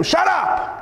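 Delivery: high, abrupt crowd rebuke; sharp rebuke. Acted. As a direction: cut him off as an irritated crowd. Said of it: "the crowds of people rebuked him".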